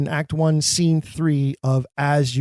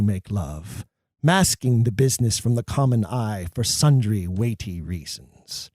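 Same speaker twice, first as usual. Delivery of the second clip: verse reading; 3 semitones lower than usual. Shown as a demonstration. Macbeth to the murderers, low measured verse. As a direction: lay out Macbeth's careful reasoning, low and measured.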